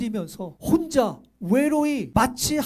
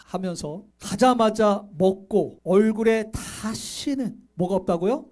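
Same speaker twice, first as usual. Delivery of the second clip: normal talking voice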